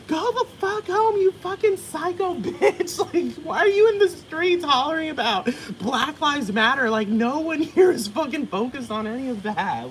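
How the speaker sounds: high, laughing mock scold; mock local yelling. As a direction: tell off a street protester in a shrill caricature.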